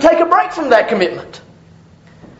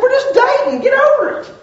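dismissive; 11 semitones higher than usual. Retold or imitated. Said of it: "I said".

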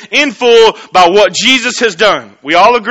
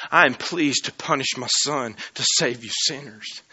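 lower, much softer, tender resolve; hushed voice of God; 5 semitones lower, 11 dB quieter than usual. Acted. God declaring his pleasure to save sinners, hushed and low. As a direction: speak it low, quietly certain. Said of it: "He said".

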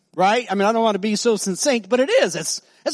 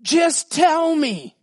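pleading; 7 semitones higher, much slower than usual. Retold or imitated. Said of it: "she goes".

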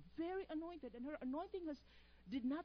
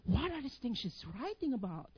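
louder slow amazed gasp; amazed exclamation; 4 semitones lower, 11 dB louder than usual. Retold or imitated. Reading his professor's notes, amazed exclamation. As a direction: marvel out loud. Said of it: "I'm like".

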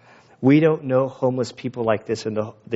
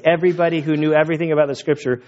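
ordinary talk

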